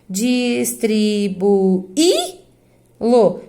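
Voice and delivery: "Distribuí-lo" very slow, Í set apart and lifted, then lo; isolated stressed I. Shown as a demonstration. Teacher repeating for students, syllables split around a stressed Í. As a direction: sound out dis-tri-bu-Í-lo, one beat each. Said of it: "Ficou sozinho na sílaba tônica".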